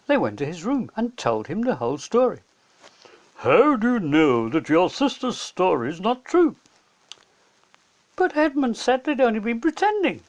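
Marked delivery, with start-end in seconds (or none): 3.4-6.6 lightly voiced old professor; gently questioning. A narrator's reading. The professor questioning Peter and Susan, lightly voiced. 8.2-10.2 put-on higher anxious child; worried protest. A narrator's reading. Susan protesting to the professor, higher child voice.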